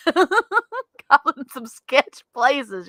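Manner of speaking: drawn-out words through real laughter; laughing. Real, not acted. Admitting to co-host about sketchy homes, laughing. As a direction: laugh your way slowly through the admission. Laughing over bad past housing.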